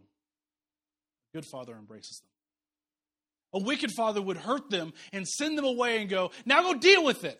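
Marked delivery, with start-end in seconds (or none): 0.0-2.3 tender good father. Acted. Contrast of good and wicked father. 6.4-7.4 loud, high barking father; harsh wicked father. Retold or imitated. Preacher voicing a wicked father, loud harsh command.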